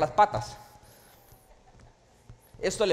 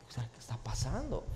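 puzzled soft aside; 6 semitones lower, 9 dB quieter than usual. Retold or imitated.

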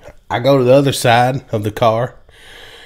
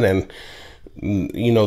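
ordinary talk

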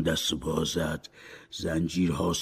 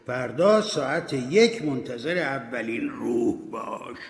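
high, loud stern command; commanding ghost; 6 semitones higher, 4 dB louder than usual. Acted. Ghost Marley tells Scrooge to await the first spirit, stern. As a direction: lay down the ghost's orders. Ghost gives final orders.

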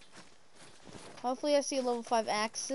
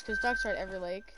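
squeaky voice; much faster than usual. Real, not acted. Squeaky fast aside.

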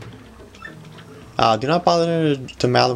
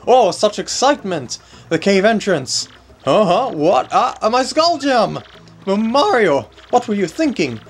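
higher, a hint of panicky nobleman; lightly voiced flustered rich man; 8 semitones higher than usual. Gamer voicing Flavio panicking about his gem.

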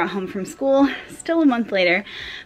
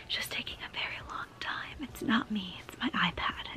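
soft unvoiced whisper; 15 dB quieter than usual. Real, not acted. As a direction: whisper it like a secret aside. Aside about slow iPad.

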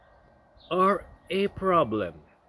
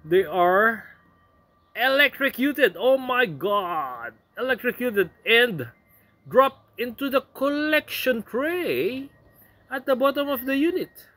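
excited reading; 8 semitones higher than usual. Real, not acted. Reading how bugs get electrocuted.

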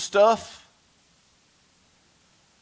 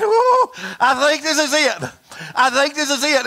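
mock panic; 9 semitones higher, 5 dB louder than usual. Jonah in the whale's belly, hammed-up dying panic. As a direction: ham up a man sure he's dying. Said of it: "and he was saying".

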